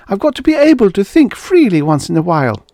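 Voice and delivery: high, fast desperate plea; pleading. Acted. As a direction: rush out the plea. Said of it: "she pleaded".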